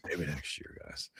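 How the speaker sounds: hushed, whispered aside. Pretend. Consoling the losing side on air, whispered. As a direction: whisper it, barely any voice.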